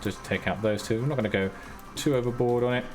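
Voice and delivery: ordinary talk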